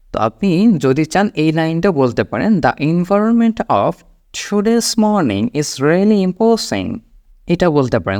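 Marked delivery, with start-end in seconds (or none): 2.6-7.0 very slow, higher-pitched English; measured phrases with careful words; slow pronunciation model. Shown as a demonstration. Teacher models an optional harder line, very slowly. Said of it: "উচ্চারণটা একটু খেয়াল করবেন".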